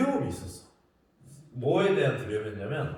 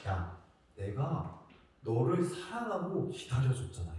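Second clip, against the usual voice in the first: lower, softer, tender voice of Jesus; Jesus speaking gently; 5 semitones lower, 7 dB quieter than usual. Retold or imitated. Jesus tells the preacher he waited for him, tender and soft. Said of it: "뭐라고 얘기하시냐면 예수님이".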